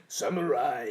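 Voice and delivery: slow, drawn-out cartoon villain; Aku. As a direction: stretch the word like a cartoon villain. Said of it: "sounds like a mix of Aku".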